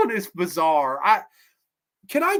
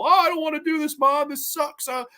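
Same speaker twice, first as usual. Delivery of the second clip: mock whine, pitched up; miserable whining; 8 semitones higher than usual. Son complaining to Mom about dentistry, mock miserable whine. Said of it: "he's miserable".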